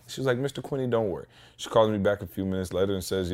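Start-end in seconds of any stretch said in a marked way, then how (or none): 0.4-1.3 reassuring. Retold or imitated. Lori reassuring him on phone.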